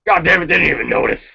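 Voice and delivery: lightly done bad Vince, muttering relief; Vince McMahon impression. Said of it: "I was doing a Vince McMahon impression".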